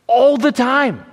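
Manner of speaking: loud, high burst of conviction; emphatic exclamation. Real, not acted. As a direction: proclaim it high and loud to the congregation. Preacher exclaiming climax of reassurance.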